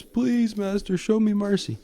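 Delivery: high pleading plea; begging for mercy. Retold or imitated. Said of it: "Maul begs for mercy".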